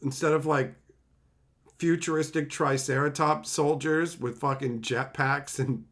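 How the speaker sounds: ordinary talk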